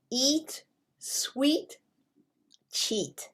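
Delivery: "Eat", "sweet", "cheat" met een lange ie-klank gerekt; long E. Shown as a demonstration. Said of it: "we've talked about long E".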